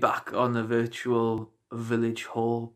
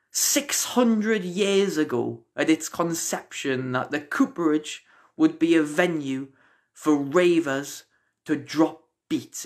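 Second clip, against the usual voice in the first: measured spoken-word recital with punched phrases; rhythmic, deliberate poem delivery; performance poetry; 4 semitones higher than usual. Acted. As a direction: recite it to a crowd, stretching key images. Recites own poem to audience.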